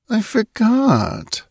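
put-on slow, high, hazy girl; muttering. A narrator's reading. Puzzled drowsy girl talking to herself, muttering. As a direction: trail it out absently. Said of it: "she muttered".

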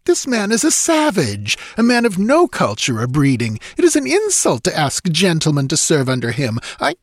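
high-pitched, indignant count; affronted aristocrat. A narrator's reading. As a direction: protest the insult to a gentleman's honour. Affronted count objects to savage.

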